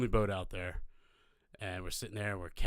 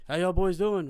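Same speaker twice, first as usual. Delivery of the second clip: high, loud friendly hail; raised, projected greeting; officer calling over; 9 semitones higher, 8 dB louder than usual. Retold or imitated. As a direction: imitate a patrolman greeting another boat. Officer calls over from patrol boat.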